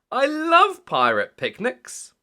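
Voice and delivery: put-on high, loud and slow little girl; high piglet voice. A narrator's reading. Peppa telling Daddy she loved shopping, high and slow. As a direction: draw it out brightly like Peppa. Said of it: "said Peppa".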